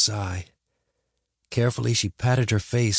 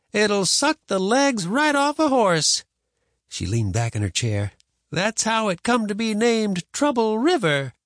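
slow, high-pitched grandma tall tale; stubborn grandma; 12 semitones higher, 3 dB louder than usual. Acted. Grandma telling boy of quicksand, stubbornly emphatic. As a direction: draw it out insistently.

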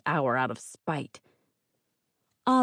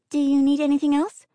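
high timid yes ma'am; meek and eager; timid girl voice; 11 semitones higher, 6 dB louder than usual. A narrator's reading. Inga asks timidly.

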